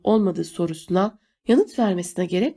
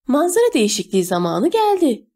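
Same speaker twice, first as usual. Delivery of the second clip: put-on high, louder squawk from an old fish-eyed man; 6 semitones higher, 4 dB louder than usual. A narrator's reading. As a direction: squawk it out, pitch leaping up. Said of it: "diye ciyakladı balık gözlü adam". (tiz sesle ciyaklayarak)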